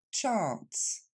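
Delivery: "Chance" with English "ch" and long "ah" as in car; English model pronunciation. Shown as a demonstration. Teacher shows French learners, English "Chance" with long "ah". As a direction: model "Chance" carefully, pitch falling. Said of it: "Encore une fois".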